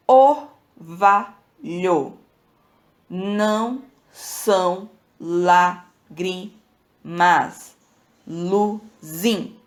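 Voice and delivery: drawn out, syllable by syllable, slow; scanning the verse very slowly with pauses; slow syllable count. Shown as a demonstration. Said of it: "a gente vai tentar fazer a contagem silábica".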